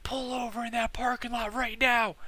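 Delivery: high, strained boss yell; mock angry yell. Boss ordering a driver to pull over, mock angry yell. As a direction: imitate an irate boss ordering someone over.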